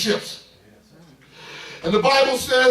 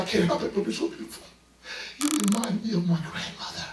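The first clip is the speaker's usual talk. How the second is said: tearful hush; 9 dB quieter than usual. Retold or imitated. Crying housekeeper speaks.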